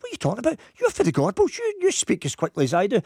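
a high, indignant wife's retort; mock wife retort. Wife scoffing at her husband's advice, mock indignant and high.

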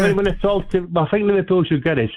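caller hamming up a bad Cockney accent; a fake Cockney caller, poorly done. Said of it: "your Cockney accent is as bad".

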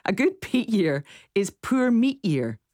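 slow, steadier attempt at a Shetland accent; host reads a Shetland listener's saying in a Shetland accent; Shetland accent retry. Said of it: "I'm trying to do the accent".

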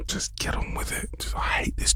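whispered impatient grumble; hushed, breathy exasperation; exasperated muttering. Real, not acted. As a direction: mutter it under the breath, fed up. Host fed up with the talk.

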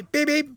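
high playful beep beep like a car horn; mock taxi horn. Radio DJ mimicking a Belfast taxi horn, playful.

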